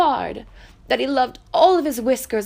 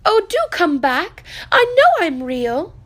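a high, louder plea from the little rabbit; little rabbit pleading; 7 semitones higher, 6 dB louder than usual. Acted. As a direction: beg them to return. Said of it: "called the little rabbit".

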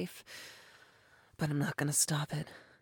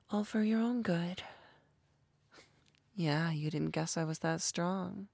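ordinary talk

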